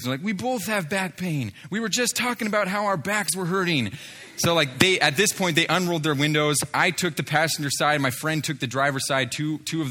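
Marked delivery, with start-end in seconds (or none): 0.2-3.9 high-pitched mock tearful voice; imitated tearful amazement. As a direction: mimic overwhelmed men, pitched up, a touch of tears. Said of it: "just started dumping tears".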